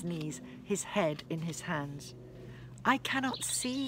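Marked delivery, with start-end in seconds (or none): 2.8-3.9 higher anguished cry of a man gone blind; a lightly voiced wail of despair. A narrator's reading. Algar struck blind, wailing to everyone around him. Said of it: "he wailed".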